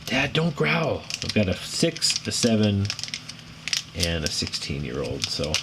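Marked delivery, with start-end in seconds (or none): 0.0-1.0 higher-pitched mimicry of a kid's protest; complaining kid. Kids telling Dad to stop growling, imitated complaint. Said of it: "drives my kids crazy".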